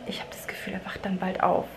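whisper, don't wake him. Real, not acted. Mother whispering near her sleeping baby, quiet whisper.

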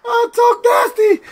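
high squeaky sigh of the name; spent laughing. Real, not acted. Streamer savouring the fake visitor, spent with laughter. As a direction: wind down from laughter.

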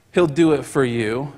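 ordinary talk, nothing marked

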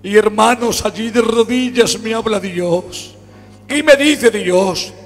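loud, high, impassioned sermon delivery; fervent Pentecostal preacher in full cry; full-force preaching. Acted. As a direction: preach it out, voice climbing. Preaching with rising fervour.

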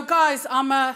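ordinary delivery

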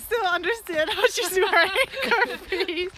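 giggling mid-sentence; laughing while teasing a friend. Real, not acted. Laughing about friend's clothes.

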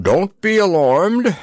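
high, slow purring reassurance; drowsy purr. A narrator's reading. Severed head reassuring the frightened two, drowsy purr. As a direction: purr it out drowsily, long vowels. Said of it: "purred Fumbo in his drowsy voice".

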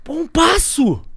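high, loud shout of thanks; a wild cry of relief; triumphant yell. Retold or imitated. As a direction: yell your gratitude at the sky.

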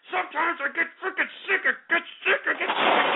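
rising high, breaking into a laugh; fed up to the point of cracking; exasperated rant. Real, not acted. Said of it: "I'm sick of you people".

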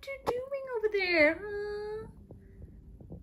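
high-pitched sing-song talk to the birds; high coo. Real, not acted. Owner cooing at her two birds, high sing-song.

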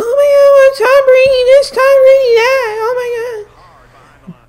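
very high, whiny mock cry; mocking whiny fan. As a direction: whine it like a crying fan. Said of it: "you guys are gonna be like".

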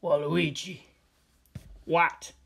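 ordinary talk

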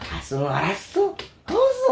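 imitated Korean intonation; mock Korean accent. Showing how Koreans' tone goes, mock Korean accent. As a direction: leap up high in fake Korean. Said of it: "pero ilang tono".

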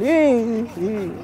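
mock-embarrassed mum letting it slip; imitating mum blurting out her son raps; mom's awkward confession. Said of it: "yo me imagino a mi mamá con sus amigas ahí".

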